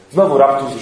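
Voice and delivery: ordinary talk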